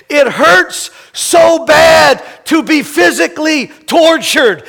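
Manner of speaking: loud, high, pained shout; shouted anguish. Acted. Pastor voicing Jesus's imagined cry, shouted anguish. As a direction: shout the pain out. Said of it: "Jesus saying".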